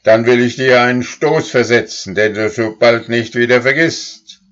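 loud, high threat; threatening cow. A narrator's reading. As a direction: dare the boy to come closer. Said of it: "sagte Mairus".

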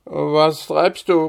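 half-asleep father calling from bed, slowly; sleepy father. A narrator's reading. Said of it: "rief er mit schläfriger Stimme".

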